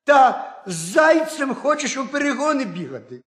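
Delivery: can't believe her husband's plan; astonished wife crying out; incredulous outcry. A narrator's reading. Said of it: "скрикнула їжиха".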